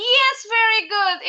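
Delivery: high, bright "Yes, very good"; excited praise. Real, not acted. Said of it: "praising pupil".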